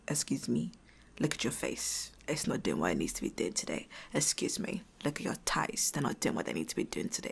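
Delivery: a muttered, menacing sergeant inspection; drill sergeant. Retold or imitated. Imagined drill sergeant inspecting her body, softly mocking. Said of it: "drill sergeant being like".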